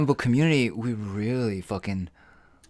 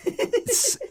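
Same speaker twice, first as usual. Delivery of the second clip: laughing. Real, not acted. Laugh heard.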